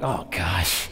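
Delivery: low, soft laughing oh gosh; groan. Retold or imitated. Dad watching the coach step in, low soft groan. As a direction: sigh it in dismay. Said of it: "I'm like".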